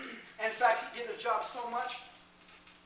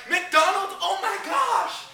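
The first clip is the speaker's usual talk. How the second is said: higher, loud, slow thrilled gasp; excited kid; 7 semitones higher, 6 dB louder, much slower than usual. Retold or imitated. Kid thrilled to hear McDonald's, slow and excited. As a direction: stretch it out in delight. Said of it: "I was excited".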